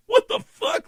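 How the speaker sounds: very high-pitched disbelief; incredulous squeal. Retold or imitated. Comedian watching the musician name the date, incredulous squeal. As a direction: let the pitch leap in amazement. Retold amazement as date is named.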